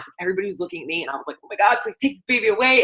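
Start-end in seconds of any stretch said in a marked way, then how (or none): 1.4-2.8 higher, louder mock panic, begging someone to take the baby; half-joking frantic plea to be rid of the crying baby; panicked plea. To her family while holding a crying baby, mock-panicked plea. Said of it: "the more I started freaking out".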